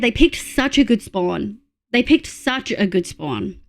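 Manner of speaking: ordinary talk, nothing marked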